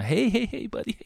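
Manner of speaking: high, sing-song friendly greeting; mock-cheerful high hello; smiling happy guy. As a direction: chirp it like a cheery, always-smiling buddy. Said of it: "like, a smiling, happy kinda".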